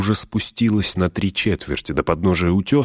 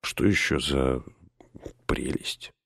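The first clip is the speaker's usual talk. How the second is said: whisper; 6 semitones lower, 8 dB quieter than usual. Acted. Sam whispers.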